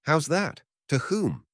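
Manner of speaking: high, startled questions; jolted disbelief, still alarmed; shocked. A narrator's reading. Barber hears his sweetheart is betrothed, shocked.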